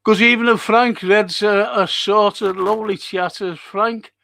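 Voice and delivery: loud high excited welcome to chatters; excited greeting. Real, not acted. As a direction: burst in bright and loud to greet them. Greeting chat reader loudly.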